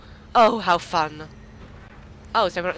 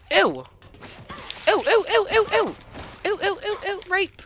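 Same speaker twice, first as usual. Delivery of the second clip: high disgusted squeals; 14 semitones higher, 3 dB louder than usual. Real, not acted.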